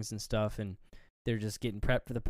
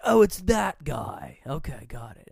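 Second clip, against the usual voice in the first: mock realization; 3 semitones higher, 7 dB louder than usual. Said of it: "You're like".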